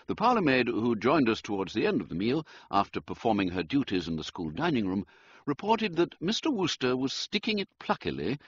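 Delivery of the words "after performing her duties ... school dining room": plain reading voice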